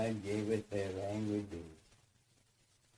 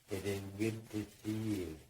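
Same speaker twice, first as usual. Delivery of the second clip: normal talking voice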